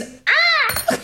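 very high hammed-up scream; fake battle cry. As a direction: shriek like a badly acted fall.

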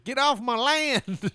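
put-on high, slow cranky old man yell; grumpy old man. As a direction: shake your fist at the kids. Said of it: "Just shaking my fist at fuckers".

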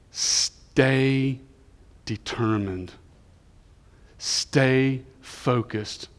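very slow, drawn-out "Stay"; solemn encouragement. Real, not acted. Preacher giving his word of encouragement, slow and weighty. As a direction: stretch each word out with weight.

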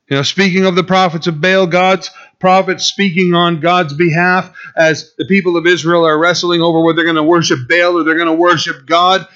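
ordinary delivery

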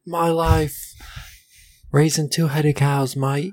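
hammed-up high-pitched British accent. Fed-up cow farmer complaining to a mate, exaggerated British accent. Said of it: "sound like they're British".

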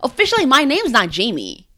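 ordinary delivery

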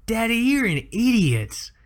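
put-on high, slow child's voice; small girl sneering at daddy; little girl voice. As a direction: play the four-year-old insulting her dad.

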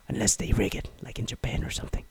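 fast whispered aside; conspiratorial whisper. Real, not acted. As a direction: hush it like a secret theory.

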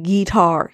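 "guitar" drawn out as GEE-tar; country "guitar". Retold or imitated. Podcaster repeating [name]'s word, "guitar" as a slow GEE-tar. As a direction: lean on a long GEE in "guitar". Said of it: "He didn't say guitar. He said guitar".

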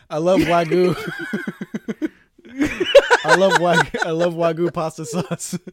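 Wagyu said with a lisping w, laughing; says w for r. Pretend. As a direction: Wagyu said with w for r, high and loud. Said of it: "a guy who can't pronounce his R's".

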